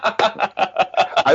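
high, real laugh breaking out; can't hold back the laugh; burst of laughter. Real, not acted. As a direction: laugh before getting the words out. Laughing at the prank memory.

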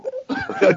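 squealing, helpless laugh; real laugh still going; high laughter. Real, not acted. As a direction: keep cracking up.

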